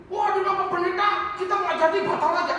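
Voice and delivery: high-pitched worried congregant backing out; mock alarm. Acts panicky congregants cancelling trip. (panik dibuat-buat, nada tinggi)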